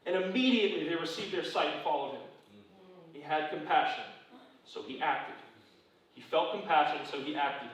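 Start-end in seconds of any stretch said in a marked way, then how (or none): none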